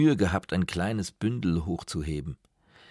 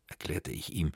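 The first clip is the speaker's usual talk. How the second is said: ordinary delivery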